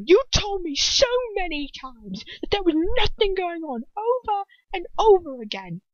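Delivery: high-pitched angry outburst; naked anger. A narrator's reading. Ron accusing Hermione of lying over and over, naked anger. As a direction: build up into shouts of anger. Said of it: "shouts of naked anger".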